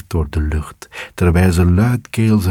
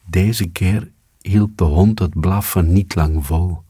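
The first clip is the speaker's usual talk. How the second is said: plain reading voice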